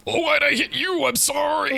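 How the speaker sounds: high-pitched, dismayed sorry; flustered apology. Real, not acted. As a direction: squeak out a startled apology. Flustered apology after hitting an ally.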